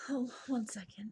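soft breathy whispered aside. Real, not acted. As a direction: whisper it off to the side.

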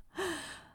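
a quick high breathy giggle; short high laugh. Real, not acted. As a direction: let a giggle slip out. Giggle in the scene.

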